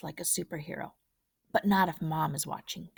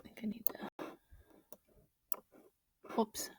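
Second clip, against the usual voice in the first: soft unvoiced murmur; whispered aside; 3 semitones higher, 15 dB quieter than usual. Real, not acted. Reader whispers a side remark mid-story. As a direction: drop to a whisper under the story. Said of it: "reader's whispered aside".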